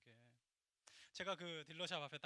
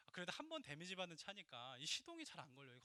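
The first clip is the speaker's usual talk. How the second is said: ordinary talk, nothing marked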